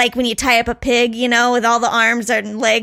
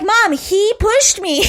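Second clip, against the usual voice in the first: put-on whiny kid protest; 8 semitones higher, slower than usual. Kids protest to mom.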